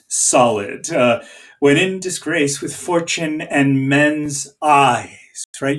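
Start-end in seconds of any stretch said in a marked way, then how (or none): 1.6-5.4 slow, every beat of "When in disgrace with fortune and men's eyes" landed hard; landing heavily. Shown as a demonstration. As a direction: plant each stressed syllable solidly, slowly. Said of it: "You really land heavily there".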